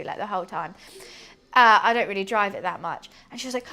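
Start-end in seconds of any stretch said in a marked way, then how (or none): none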